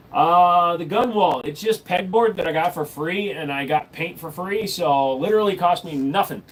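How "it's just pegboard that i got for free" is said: ordinary talk, nothing marked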